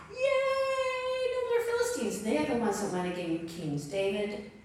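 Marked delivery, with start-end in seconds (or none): 0.1-2.2 high excited cheer; mock crowd cheer.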